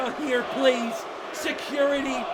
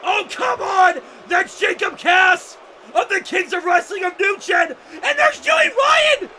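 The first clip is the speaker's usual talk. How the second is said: loud protest climbing higher; raised, incredulous ringside commentary; outraged call; 5 semitones higher, 5 dB louder than usual. Real, not acted. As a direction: call out the intruders in indignant shouts.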